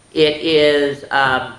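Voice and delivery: normal talking voice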